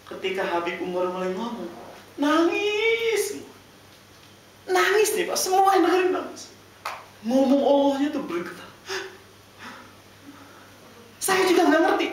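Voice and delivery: very high, loud, overcome retelling; emotional high cry. Real, not acted. Preacher recalling everyone weeping before Habib Umar, high and loud. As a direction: cry it out as if still moved.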